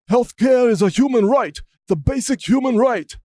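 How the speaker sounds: hammed-up imitation of a declaiming senator; Bernie Sanders impression. Senator declaring healthcare a right, hammed-up impression. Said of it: "That's my impression of Bernie Sanders right there".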